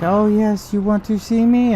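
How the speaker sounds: high, sing-song imitation of an eager scammer; mock scammer voice. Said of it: "it's like".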